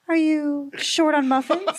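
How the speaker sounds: high, slow, snobby lady. Pretend. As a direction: ask it like a rich snob. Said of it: "whisper in her ear".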